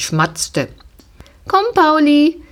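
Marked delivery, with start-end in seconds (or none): 1.5-2.4 higher, louder cheerful call. A narrator's reading. Girl cheerfully calling her pony to come along.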